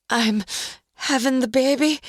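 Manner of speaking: high strained moan through teeth; moaning in labor. A narrator's reading. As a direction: push the words out in pain. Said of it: "Roz moaned through gritted teeth".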